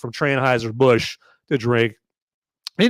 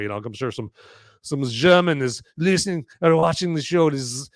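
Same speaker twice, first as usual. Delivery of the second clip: ordinary talk, nothing marked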